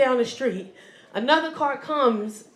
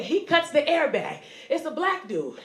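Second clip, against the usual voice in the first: ordinary talk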